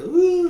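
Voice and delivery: a quick hammed-up sob; mock sob. Fan explaining how he teared up, mock sob. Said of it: "it wasn't like a".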